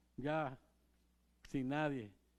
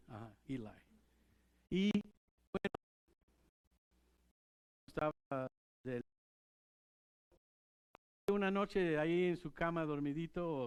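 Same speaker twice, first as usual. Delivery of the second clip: ordinary talk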